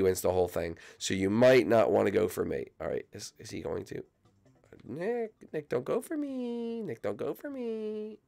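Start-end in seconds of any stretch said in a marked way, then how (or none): 4.9-8.2 high pleading. Acted. Begging Nick in high voice.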